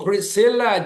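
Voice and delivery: usual speaking voice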